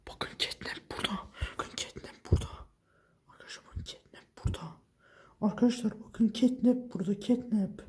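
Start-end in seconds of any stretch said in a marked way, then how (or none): 0.1-2.5 hushed, pointing it out to viewers; hushed warning to viewers; whisper. Real, not acted. Whispering while the monster is near. 3.3-4.8 hushed, telling viewers quietly; whispered to the viewers. Real, not acted. Whispering, monster near.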